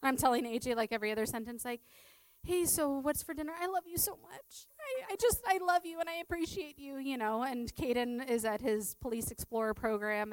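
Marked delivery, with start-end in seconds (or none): 2.4-7.0 retold emotional gushing. Retells tearfully gushing love to husband.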